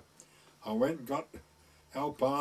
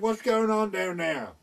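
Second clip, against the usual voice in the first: calling down from a window; 7 semitones higher, 4 dB louder than usual. Retold or imitated. Woman calls from bedroom window.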